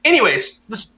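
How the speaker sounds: ordinary delivery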